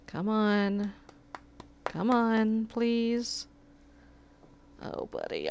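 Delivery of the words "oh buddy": unvoiced breathy whisper; whispered oh buddy; whisper to the cat. Real, not acted. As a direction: hush the cat gently.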